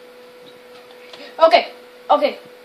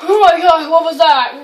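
loud, tearful gasp of shock; startled fright; 5 dB louder than usual. Real, not acted. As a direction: blurt it out, badly startled. Startled by game scare.

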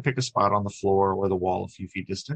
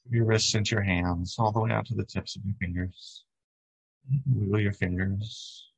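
calm, even meditation guide with long pauses; unhurried body-scan voice, settling at phrase ends; guided meditation. Acted. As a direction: lead them gently through breath and body.

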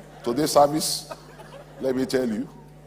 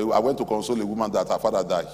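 normal talking voice

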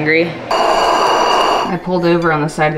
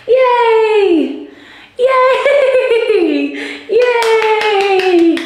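cheering; 3 dB louder, much slower than usual. Real, not acted. Mom cheering her toddler for opening it, high and loud.

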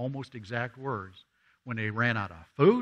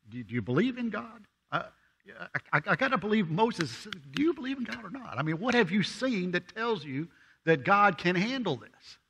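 higher, pressing questions; exasperated questioning; 7 semitones higher than usual. Acted. Preacher voicing Moses's exasperation at the people, higher. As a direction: push him, incredulous.

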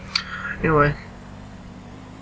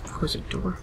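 breathy whisper, much softer; whispered aside; 3 semitones higher, 10 dB quieter than usual. Real, not acted. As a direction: whisper it so nobody hears. Quietly shutting door in game.

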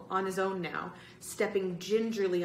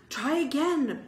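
high, loud, insistent prompt; urging; 8 semitones higher, 5 dB louder than usual. A narrator's reading. Boy urging Greg at the stuck doors, high loud urging. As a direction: urge him loudly to have another go. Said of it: "Bird urged Greg".

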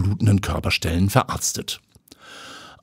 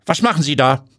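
reproachful; 7 semitones higher, 3 dB louder than usual. Acted. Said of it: "fragt eine vorwurfsvolle Frauenstimme".

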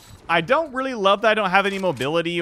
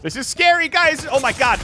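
higher, louder alarmed cry; half-playful scared yelp; 8 semitones higher, 5 dB louder than usual. Real, not acted. Gamer telling viewers the fight is scary.

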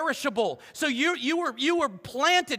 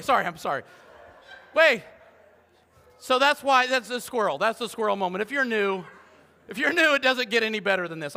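usual speaking voice

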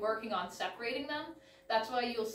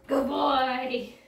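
loud praise; 3 semitones higher, 9 dB louder than usual. Real, not acted. Praising dog after tug.

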